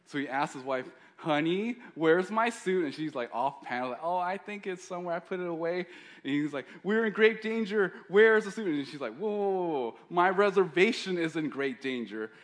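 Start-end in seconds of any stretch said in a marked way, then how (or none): 1.2-2.9 loud, higher call across the house. Retold or imitated. Superhero husband calling to wife. 4.0-5.9 lightly voiced wife. Wife answering offhand, off panel. 6.8-8.7 much higher, loud urgent yell; panicked shout. Retold or imitated. Superhero panicking at wife. 9.2-12.3 higher, loud indignant whoa-whoa; sassy wife. Retold or imitated. Wife scolding husband.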